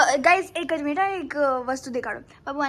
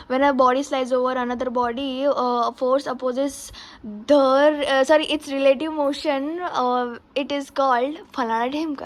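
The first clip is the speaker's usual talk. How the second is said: quick demo; much slower than usual. Shown as a demonstration. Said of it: "જો હું બોલું".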